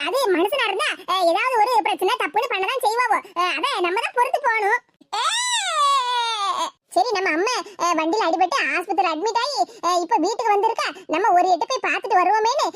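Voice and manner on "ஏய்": drawn-out theatrical bawl; one stretched falling wail; hammed-up sob held very long; long wailing cry. Daughter keeps bawling during mother's plea.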